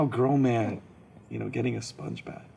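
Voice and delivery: usual speaking voice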